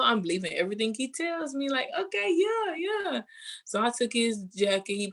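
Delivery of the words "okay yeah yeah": much higher, gushing mock agreement; squeaky high eager yeah; eager naive yes. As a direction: play the smitten girl who believes everything. Retelling her eager, naive agreement.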